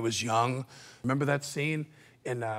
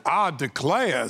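slow mock Southern accent; exaggerated Southern drawl; Al Pacino; slower than usual. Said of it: "he's got, like, a southern accent".

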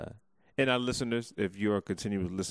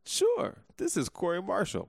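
odd high voice; 9 semitones higher than usual. Pretend. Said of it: "Why do you sound like that?".

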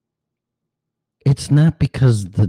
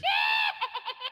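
shrieking laugh; 13 dB quieter than usual. Retold or imitated. Mocking dog owner laughs.

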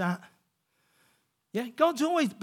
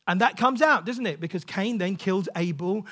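ordinary talk, nothing marked

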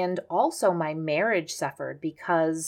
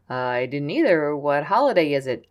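playing dumb; 3 semitones lower, 4 dB louder than usual. Mom's mock-innocent reply.

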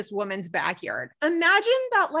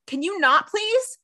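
annoyed plea; 9 semitones higher, slower than usual. Retold or imitated. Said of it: "Severide's like".

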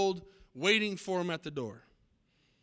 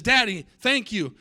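put-on overjoyed little boy, much louder and higher; beaming boy; 4 semitones higher, 8 dB louder than usual. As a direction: burst out with a child's delight. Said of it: "beaming, and says".

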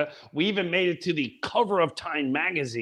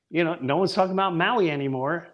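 usual speaking voice

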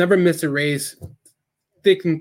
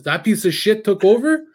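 mock outraged question, pitched up; imitating incredulous father; 5 semitones higher, 3 dB louder than usual. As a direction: retell a dad's scornful disbelief, higher. Retold father's disbelieving outburst.

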